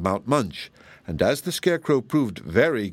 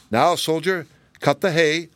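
higher-pitched character voice giving orders; commanding Scarecrow; 7 semitones higher, 3 dB louder than usual. A narrator's reading.

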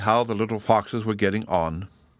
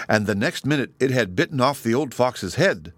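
usual speaking voice

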